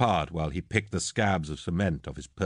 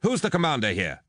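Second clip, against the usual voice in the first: higher-pitched, imperious demand; 7 semitones higher than usual. A narrator's reading. Arriving lord to unknown officers, demanding. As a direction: demand to know who is in charge. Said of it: "a voice demanded".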